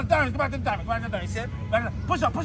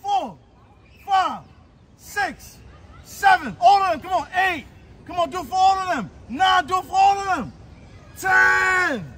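shouting; 8 semitones higher than usual. Real, not acted. Coach counting reps aloud.

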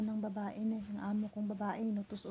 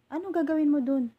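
exasperated question; 5 semitones higher, 8 dB louder than usual. Retold or imitated. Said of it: "Sabi niya ganun".

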